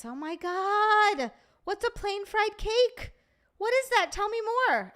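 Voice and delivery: high, drawn-out eager amazement; excited. Real, not acted. Streamer begs viewer about fried cake, excited. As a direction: gush with rising pitch, hungry for details. Thrilled by viewer's dessert description.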